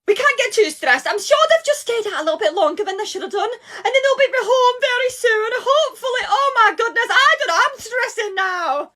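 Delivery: very high, anxious grandmother voice; a nan panicking about her missing granddaughter; panicked nan. Acted. Nan phoning Kelly about Emma, high and panicked.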